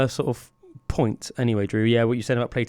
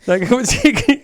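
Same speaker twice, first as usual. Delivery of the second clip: laughing; 9 semitones higher, 5 dB louder than usual. Real, not acted. Amused disbelief at friend.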